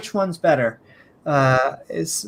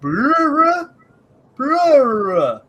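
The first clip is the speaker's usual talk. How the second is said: mock caveman grunts; 10 semitones higher, much slower than usual. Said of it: "two guys looked at a river and went".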